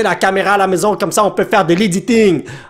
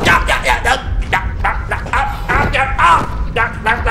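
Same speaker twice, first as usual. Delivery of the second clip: panicked stutter cries; 3 semitones higher than usual. Real, not acted. Being eaten by spider.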